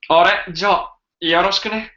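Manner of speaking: a hint of a too-cool protagonist; cool anime hero. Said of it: "the main character is too cool to be formal".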